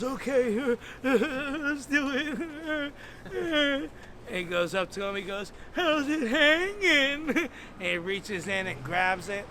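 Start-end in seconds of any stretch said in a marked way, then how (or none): 0.0-4.0 high, slow whimpering agreement; mock tearful. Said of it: "His damn tears were coming out". 5.7-7.8 high, drawn-out weepy greeting; mock tearful. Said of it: "His damn tears were coming out".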